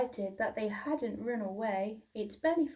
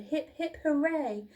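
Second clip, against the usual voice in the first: higher, cheering hoot; happy cheer; 5 semitones higher, 4 dB louder than usual. A narrator's reading. Duckbills cheering for Drip, happily. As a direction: cheer like a crowd. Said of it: "hooted happily".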